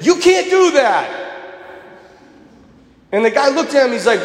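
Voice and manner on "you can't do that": high, drawn-out protest; emphatic retold objection. Teammate stopping an angry lineman, slow emphatic protest. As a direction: blurt out a firm, high objection.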